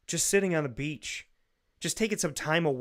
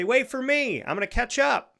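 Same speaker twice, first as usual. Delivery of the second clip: much higher and louder call to friends ahead; 10 semitones higher, 6 dB louder than usual. Retold or imitated. Running after coworkers heading to lunch, loud call. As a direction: shout after them so they wait.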